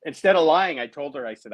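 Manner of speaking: ordinary talk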